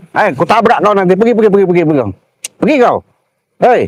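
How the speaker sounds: high, insistent angry warning; angry threat. Real, not acted. Driver threatening to ram the blocker, high angry orders. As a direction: drive him off with repeated high-pitched orders.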